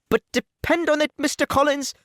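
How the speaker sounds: an anxious mother promising to bring her daughter round; Mrs Bennet, fussing. Acted. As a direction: gush reassurance at the suitor. Mrs Bennet reassures Mr Collins.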